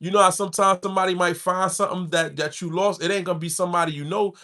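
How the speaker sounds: usual speaking voice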